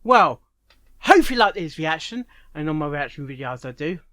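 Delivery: normal talking voice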